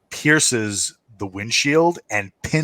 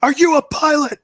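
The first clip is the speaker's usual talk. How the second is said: high, loud barked question; soldier voice; 4 dB louder than usual. Acted. Soldier asking Nick if he can fly. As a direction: demand it urgently. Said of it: "he goes".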